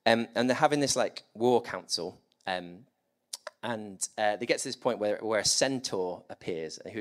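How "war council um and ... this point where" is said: ordinary delivery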